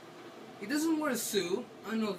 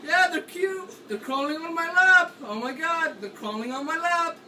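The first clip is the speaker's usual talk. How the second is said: high, loud, forced cheerfulness; picture puppies to drown out the fear; forced happy place; 7 semitones higher, 7 dB louder than usual. Real, not acted. Frightened player forces himself into a happy place, high and loud. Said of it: "In a happy place".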